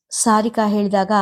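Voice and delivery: ordinary delivery, nothing marked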